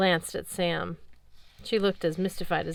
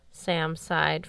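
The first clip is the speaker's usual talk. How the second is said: plain reading voice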